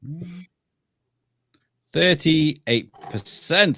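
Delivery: game-show host unveiling the result; dramatic countdown reveal. Acted. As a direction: hold them in suspense like a quiz host, then land the score.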